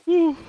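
short, loud, high whoop; real burst of triumph; excited whoop. Real, not acted. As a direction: let out a sudden high "woo". Thrilled hunter after rare kill.

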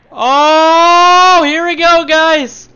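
high, loud thrill; excited shout. Real, not acted. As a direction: shout it like a rollercoaster drop. Excited sliding downhill.

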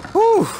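sharp high gasp of fright; real startled gasp; high startled whew. Real, not acted. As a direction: yelp as the scare lands. Another jump scare.

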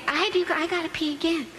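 lightly voiced insistent little girl; a hint of a small child pleading; mild whiny kid voice. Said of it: "The five-year-old little girl says".

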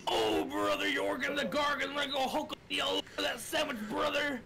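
high shouting wrestler hype voice; Hulk Hogan voice. Retold or imitated. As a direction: yell it like a pumped-up pro wrestler. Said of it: "talk in the Hulk Hogan voice".

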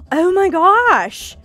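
sharp gasp, then a slow amazed oh my gosh; gasp of surprise. Real, not acted. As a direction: react with a startled gasp. Gasp at game drop.

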